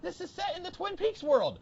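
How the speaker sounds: very high, frenzied fan squeal; frantic excitement. Retold or imitated. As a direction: spew it out, giddy with discovery. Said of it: "flailing my arms around like a madman".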